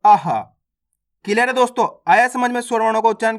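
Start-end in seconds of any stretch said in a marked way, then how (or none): 0.1-0.4 "आहा" as short "a" plus "ha", pitched low; a followed by ha. Shown as a demonstration. Teacher repeats the last vowel, "आहा" in two beats. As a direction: finish "आहा" on a distinct "ha". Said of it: "इस प्रकार से इसका उच्चारण".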